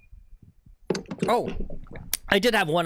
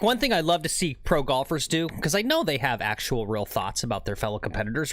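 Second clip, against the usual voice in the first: ordinary talk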